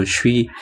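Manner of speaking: "suis" run together as "shwee"; "suis" with an sh onset, short for je suis; quick contracted form. Shown as a demonstration. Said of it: "Biar cepet gitu".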